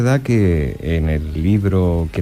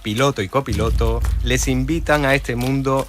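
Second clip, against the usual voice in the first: upbeat radio intro voice, higher; radio announcer; 4 semitones higher, 4 dB quieter than usual. Acted. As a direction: announce the show over music. Radio show intro over music.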